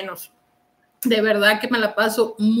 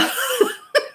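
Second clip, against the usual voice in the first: a sudden high, breathy laugh; giggling fit; high burst of laughter; 11 semitones higher than usual. Real, not acted. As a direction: crack up at the joke.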